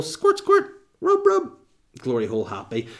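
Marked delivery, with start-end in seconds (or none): 0.0-1.5 high squeaky voice for the spray and rub; squeaky squirt noises. Pretend. Joking about anti-covid spray, squeaky high voice. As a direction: squeak the words like a toy spray bottle. Mock sound effects of spraying.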